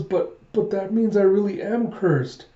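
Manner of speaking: high, slow, stammered protest; a little kid pleading through mock tears; upset child. Crying child realizing he really is cursed, upset.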